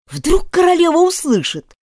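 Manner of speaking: high, anxious warning; frightened. A narrator's reading. Rabbit fearing the queen hears, frightened warning. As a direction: warn her in an alarmed high voice. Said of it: "испугался кролик".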